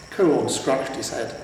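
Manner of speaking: plain reading voice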